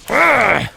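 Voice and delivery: high effort yell; drawn-out high battle grunt; combat grunt. Pretend. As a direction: strain into a sword swing. Effort noise during game combat.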